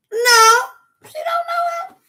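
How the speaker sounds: very high squealed protest; hammed-up high-pitched refusal; acted shrieked denial. Acted panicked reply to bystanders.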